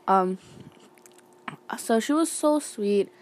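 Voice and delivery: ordinary talk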